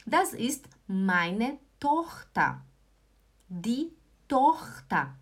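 slow, word-by-word delivery; slow model sentence. Shown as a demonstration. Teacher modelling German for beginners, slowly spaced. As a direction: leave space between each word.

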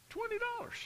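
high, soft squeak of disbelief; incredulous. Retold or imitated. Shocked diner to friend over a huge tip, incredulous. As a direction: say it high and small, aghast at the amount.

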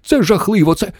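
high, alarmed cry cut short; yelling in alarm. A narrator's reading. Mission Control panics before being cut off, yelling. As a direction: wail it in alarm. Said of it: "заволав центр керування".

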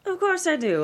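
high and loud, offended; loud high-pitched objection; defiant protest. Acted. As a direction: bristle at the suggestion. Oprah rejecting Gail's point.

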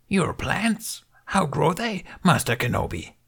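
lightly voiced Yoda. A narrator's reading. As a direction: ask it like a knowing old master. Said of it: "Yoda smiled".

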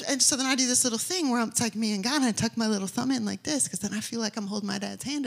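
usual speaking voice

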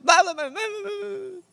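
high blahs fading away; mock whining babble. Pastor sending up own complaints, high mock babble. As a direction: let the griping dissolve into high-pitched nonsense.

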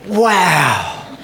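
bright amazed wow; demo wow. Shown as a demonstration. Preacher showing the congregation the wow signal. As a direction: show them how to say it. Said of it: "you're going to say".

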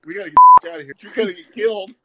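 high, loud alarmed urgency; panicked warning. Retold or imitated. Friend urgently warning him to leave the joint, panicked and loud. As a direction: yell it like you're about to get killed. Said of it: "looks at me and goes".

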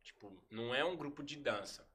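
a hint of the calm leader laying down the rules; calm mentor voice. Quoting T H explaining the crew is serious, mildly soft and calm. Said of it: "no mesmo jeito que ele era manso, nesse jeito de falar".

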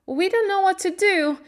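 high, loud, helpless cry; distressed cry. A narrator's reading. Martha running in to Mary, distressed cry. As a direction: cry out for help, pitched high. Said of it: "she cried".

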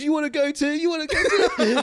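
high, light imitation of a cook's dare; mock challenge. Cook daring the bullying chef, high mock challenge. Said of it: "he turns around and he goes".

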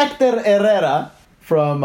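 "Hector Herrera" slowly, Spanish way; "Herrera" with a trilled R and a silent H; Spanish pronunciation. Shown as a demonstration. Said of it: "Go, take it".

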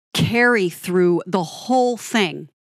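normal talking voice

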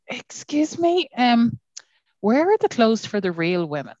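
high, slow, prim customer; slim shopper voice. Retold or imitated. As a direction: play the dainty slim woman. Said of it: "And she said".

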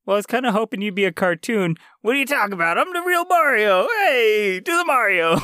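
high-pitched hammed-up cartoon showman; Mario from the Super Show. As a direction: push it up high like an old TV mascot. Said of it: "getting the Mario from Mario Super Show".

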